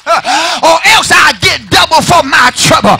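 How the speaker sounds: high, loud whooping cry; whooping preacher. Acted. Pastor whooping to the church at the climax.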